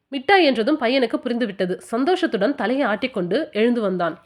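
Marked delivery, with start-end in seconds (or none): none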